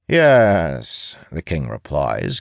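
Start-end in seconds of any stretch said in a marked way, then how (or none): 0.1-1.2 arrogant smirk. Acted. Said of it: "giving her an arrogant smirk".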